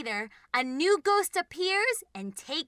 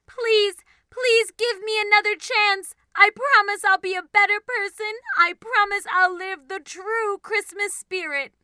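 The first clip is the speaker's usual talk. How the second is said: raised, louder plea for another chance; regretful pleading; 5 semitones higher, 6 dB louder than usual. A narrator's reading. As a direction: plead earnestly, with a touch of regret. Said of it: "Regretful of his behavior".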